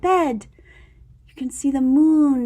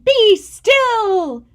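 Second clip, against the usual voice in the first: higher, louder order; commanding shout; 8 semitones higher, 7 dB louder than usual. Acted. Max pointing at the monsters, a loud command. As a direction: command them to freeze.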